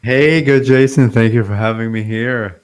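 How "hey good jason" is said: normal talking voice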